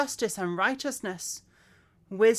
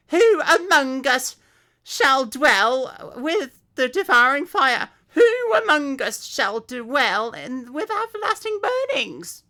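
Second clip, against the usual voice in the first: special voice; 5 semitones higher, 7 dB louder than usual. Shown as a demonstration. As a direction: wail it like a terrified sinner. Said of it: "I'll do a special voice for that".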